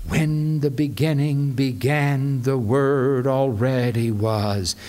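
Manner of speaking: low, measured recitation; solemn scripture reading. Shown as a demonstration. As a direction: hold it low and grave, saving the climax. Demonstrates reading that builds to climax.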